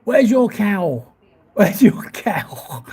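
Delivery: slow, drawn-out mock of a bloke's catchphrase; imitating a street bloke. A bloke pestering passers-by, slow mocking imitation. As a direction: ham up the bloke's repeated question, slowly. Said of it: "walks around saying".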